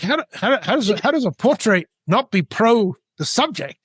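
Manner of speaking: high-pitched, baffled comeback; incredulous. Retold or imitated. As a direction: retell it as a disbelieving protest. Retells his stunned comeback.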